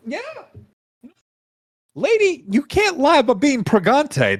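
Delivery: high, loud scolding voice; indignant Jin. Acted. Jin scolding a lady for lying, high and indignant. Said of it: "He's like".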